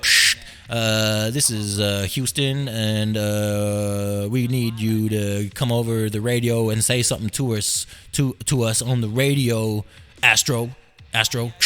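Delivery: low, soft radio operator drone; mission control. Acted. Houston calling the astronaut by radio, low mission-control voice. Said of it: "someone said".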